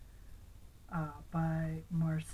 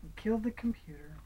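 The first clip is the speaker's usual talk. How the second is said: plain reading voice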